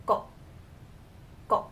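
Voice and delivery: "go" begun with a tight G sound; isolated "go" with a firm G. Shown as a demonstration. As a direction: say "go" as a model word, G sound up front. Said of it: "has the G sound".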